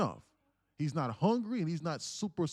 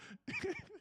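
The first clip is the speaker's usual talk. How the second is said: helpless high-pitched laugh; high squeal of laughter; 7 dB quieter than usual. Real, not acted. Laughing at his own wildebeest story, a high squeal. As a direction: squeak out a laugh you can't hold back. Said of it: "I'm laughing 'cause it's true".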